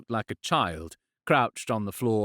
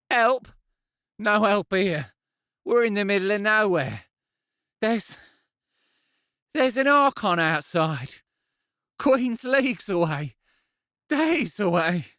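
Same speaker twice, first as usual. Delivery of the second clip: higher and louder, desperate half-laugh; despairing, voice breaking; 10 semitones higher, 4 dB louder than usual. Acted. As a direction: panic rising until the voice cracks. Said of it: "His voice broke".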